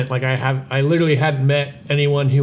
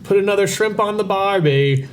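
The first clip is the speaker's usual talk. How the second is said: hammed-up Australian accent, higher; a quick cliché Australian voice, pitched up; mock Australian accent; 7 semitones higher than usual. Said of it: "it's like".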